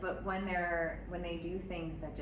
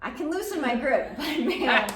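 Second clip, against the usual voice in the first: higher, louder laughing admission; 8 semitones higher, 10 dB louder than usual. Real, not acted. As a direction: laugh through the confession. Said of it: "laughing admission".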